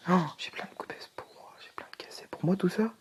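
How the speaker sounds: soft breathless whisper. Real, not acted. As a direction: whisper it in wonder. Delighted whisper finding loot.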